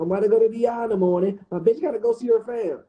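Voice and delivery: whiny mock of his friend's excuse; mocking Shaq. Mocking his friend's trip excuse, high and fast. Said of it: "He told me".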